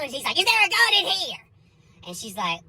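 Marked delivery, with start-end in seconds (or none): 0.4-1.4 higher, more emphatic repeated demand; freaked-out bailiff, more insistent. Retold or imitated. Bailiff pressing the old lady harder, mock panic. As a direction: imitate growing alarm, pushing the pitch right up. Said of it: "The bailiff starts freaking out".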